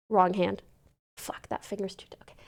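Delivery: ordinary talk, nothing marked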